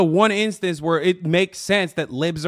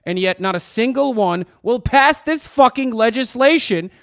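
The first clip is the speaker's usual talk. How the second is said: louder, higher, building rant; heated rant; 6 semitones higher, 6 dB louder than usual. Real, not acted. Streamer ranting about pundits, loud and rising.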